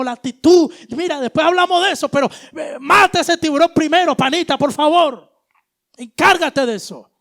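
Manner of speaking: loud, high, pushy prayer that bargains with God; demanding believer. Retold or imitated. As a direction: insist loudly that God fix it first. (creyente exigente que le grita órdenes a Dios)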